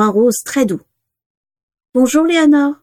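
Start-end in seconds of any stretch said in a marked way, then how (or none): 1.9-2.7 put-on high, small girl's voice; thin little voice. A narrator's reading. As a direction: greet in a tiny piping child voice. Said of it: "dit-elle d'une voix fluette".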